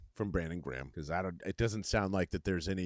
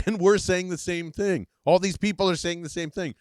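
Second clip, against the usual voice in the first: higher and louder, worked-up insistence; exasperated; 7 semitones higher, 6 dB louder than usual. Real, not acted. Host to fans who share his view, exasperated and loud. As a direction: hammer it home, fed up.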